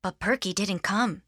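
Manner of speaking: plain reading voice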